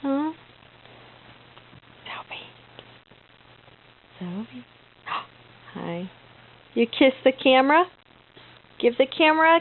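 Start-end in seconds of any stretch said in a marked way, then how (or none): 2.1-4.7 whispered name. Real, not acted. Calling baby softly. 5.1-6.1 hushed surprised hi; soft gasp. Real, not acted. Surprised greeting to baby.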